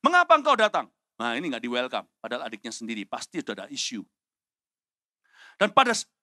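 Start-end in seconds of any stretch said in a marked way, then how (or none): 0.0-0.9 high, loud angry shout; angry outburst. Acted. Older brother rounding on Daud as he arrives, angry shout. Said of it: "bangkitlah amarahnya pada Daud sambil berkata". 5.6-6.1 loud, high angry burst; acted angry outburst. Older brother starting to berate Daud, angry burst. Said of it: "bangkitlah amarahnya pada Daud".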